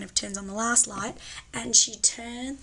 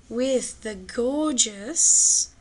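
gushing; 5 dB louder, much slower than usual. Acted. Gushing over Grace Kelly.

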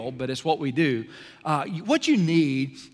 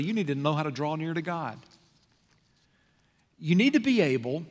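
usual speaking voice

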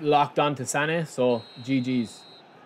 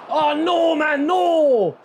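1 anguished shout; 12 semitones higher, 5 dB louder, much slower than usual. Real, not acted. Chance missed in game.

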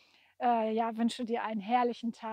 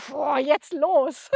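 louder, drawn-out burst of drive; fired-up; 3 semitones higher, 7 dB louder, slower than usual. Acted. Painter showing how much power the poem gives her, fired-up. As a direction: push it out like a powerful launch. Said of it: "so viel Kraft und so viel Power".

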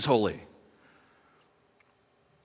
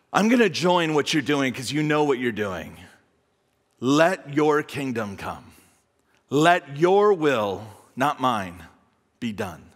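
prayerful; 7 semitones lower than usual. Shown as a demonstration. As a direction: pray it gently, surrendering. Prayer voiced as model for congregation.

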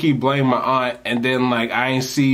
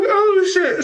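very high, stunned "Oh shit"; amazed; 3 dB louder than usual. Retold or imitated. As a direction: squeal in disbelief. Surprised he got the number.

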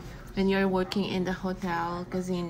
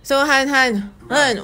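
louder, higher calling out; 5 semitones higher, 11 dB louder than usual. Real, not acted. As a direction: call across the room to get his attention. Calls partner louder for answer.

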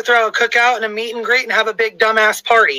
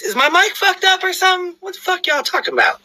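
confused; 9 semitones higher than usual. Retold or imitated.

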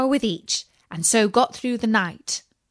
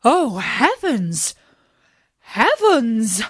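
louder, slower cries of wonder; astonished cry; 2 semitones higher, 3 dB louder, slower than usual. A narrator's reading. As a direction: dwarfs gasp in astonishment. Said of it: "cried they".

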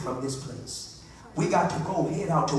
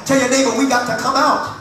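loud, raised-pitch scripture exhortation; fervent pulpit charge, building; full-force preaching; 6 semitones higher, 8 dB louder than usual. Real, not acted. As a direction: proclaim it to the whole congregation.

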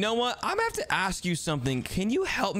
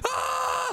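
startled scream. Acted. Monsters in the house.

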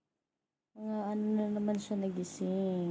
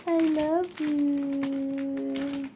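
tender cooing; 10 semitones higher, 4 dB louder, much slower than usual. Real, not acted. Mother cooing love to her baby, tender.

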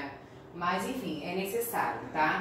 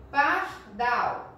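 "Pardal" louder, in two separated syllables; 3 semitones higher, 5 dB louder than usual. Shown as a demonstration. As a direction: split "Pardal" into two firm syllables with a gap between. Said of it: "Dal é a sílaba tônica".

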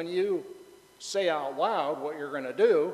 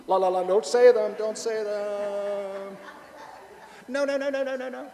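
high, playful la-la-la; sing-song childish refusal, higher; mock covering ears; 7 semitones higher, 4 dB louder than usual. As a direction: pretend you won't hear it. Pretends to block swear words.

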